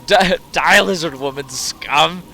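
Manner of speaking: laughing mock battle cry; hammed-up crazed taunt; unhinged psycho. Insulting game character.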